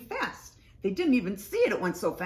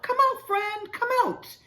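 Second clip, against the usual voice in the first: high-pitched excited call; shouting; 4 dB louder than usual. A narrator's reading. Mouse shouts to a hidden friend to come out, shouting. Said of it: "Mouse starts to shout".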